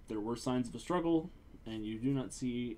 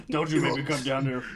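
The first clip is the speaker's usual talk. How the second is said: louder, playful threat; mock intimidation; 7 dB louder than usual. Joking that the player is intimidating the house, mock-menacing. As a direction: lean on them with a mock threat. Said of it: "He's intimidating that house".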